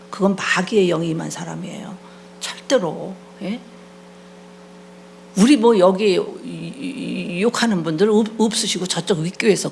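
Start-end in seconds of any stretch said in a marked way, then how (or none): none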